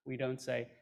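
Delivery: ordinary delivery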